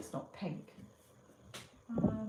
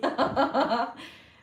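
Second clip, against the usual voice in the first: laugh burst; 6 semitones higher, 11 dB louder than usual. Real, not acted. Laughing at her bababoo joke.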